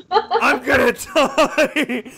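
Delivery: laughing. Real, not acted. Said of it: "vocal cords are stretched".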